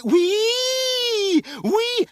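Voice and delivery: very high siren squeal; long, loud wailing "whee"; screaming like an engine. A narrator's reading. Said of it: "screaming like some kind of engine".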